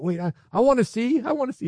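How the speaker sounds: high, pleading mock impatience; acted eagerness. Preacher voicing believers at the wall, high acted eagerness. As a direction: play a keen onlooker straining for a look.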